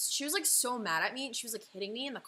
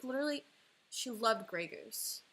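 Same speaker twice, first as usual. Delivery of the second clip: usual speaking voice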